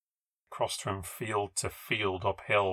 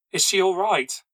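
high, louder worried question; alarmed question; 9 semitones higher, 5 dB louder than usual. A narrator's reading. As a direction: ask urgently after someone hurt. Chloe alarmed over injured Rose.

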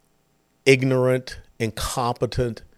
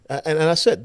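ordinary talk

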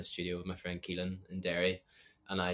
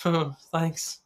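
retold awkward thanks; 10 semitones higher, 11 dB louder than usual. Embarrassed reply to manager acted out.

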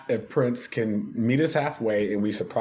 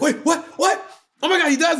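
much higher and louder; stunned; 4 dB louder, faster than usual. Retold or imitated. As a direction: gasp it in disbelief. Producer stunned by news.

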